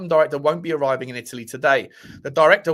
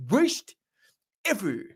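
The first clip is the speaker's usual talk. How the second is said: high cartoon voice, each word set apart; Comic Book Guy; 6 semitones higher, slower than usual. Streamer repeating the catchphrase to show off, Comic Book Guy impression. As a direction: stretch out the cartoon catchphrase with a pause. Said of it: "pretty good impression of comic book guy".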